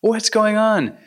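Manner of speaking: freaking out. Retold or imitated. Wealthy man panicking as his gold turns to ash, alarmed cry. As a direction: blurt it out in alarm as the gold crumbles.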